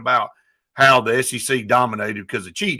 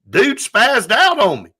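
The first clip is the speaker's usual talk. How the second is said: retold exclaim; 9 semitones higher, 4 dB louder, slower than usual. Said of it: "He said".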